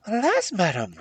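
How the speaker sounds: high, loud princess voice; sorrowful princess. A narrator's reading. Princess answers suspicious hag, sorrowfully. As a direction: lament as a storybook princess.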